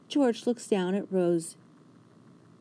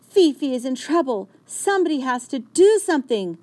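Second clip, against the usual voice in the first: higher, louder urgent narration; rising tension; 7 semitones higher, 7 dB louder than usual. A narrator's reading. Narrator tells of Fifi in trouble, urgent. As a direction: build the danger.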